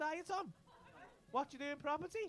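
ordinary talk

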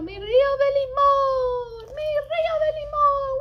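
high, loud joyful exclamation; ecstatic cry. Acted. Storyteller to kids, crying out high and loud with joy. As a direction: throw the line up high and loud in delight. Climactic exclamation over lemon river.

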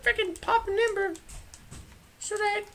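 very high squeaky voice; falsetto. Pretend.

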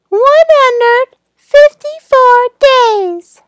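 very high, loud, excited counting. Shown as a demonstration. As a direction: build up the counting with delight.

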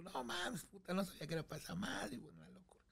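whispered, fast and breathless; hushed whisper. Retold or imitated. Friend secretly whispering his amazement at the ring. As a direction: lean in and whisper the disbelief.

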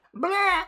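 very high, loud squeaky blah; bat death squeak. Pretend. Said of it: "That's a bat dying".

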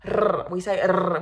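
rrr trilled long on the tongue; Finnish rolled R. Shown as a demonstration. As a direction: roll the rrr like a Finn. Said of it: "this is how we pronounce".

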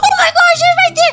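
high, loud laughing shriek; excited shriek. Real, not acted. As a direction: squeal on finding them. Finds the fans.

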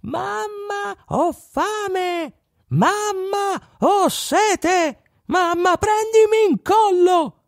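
very high, squeaky little voices calling; a hundred tiny chickpea kids yelling for mamma; tiny children crying out. Acted. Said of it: "cominciarono a gridare".

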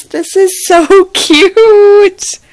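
slow, loud, laughing cute; laughing delight. Real, not acted. Vlogger gushes over the gift, laughing and loud. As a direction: break into a laugh mid-line.

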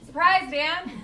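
loud, high gleeful call to Dan; spring the surprise on him, loudly; excited shout. Real, not acted.

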